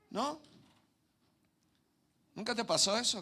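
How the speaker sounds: ordinary talk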